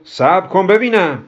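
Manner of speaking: high, loud, stretched-out objection; protesting. Acted. Kobra interrupts Hamud to object, loud protest. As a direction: object loudly, holding the words back. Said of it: "با اعتراض گفت".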